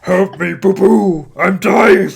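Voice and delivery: loud, high desperate dying cry; dying words. Pretend. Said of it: "make the sound of a dying bear".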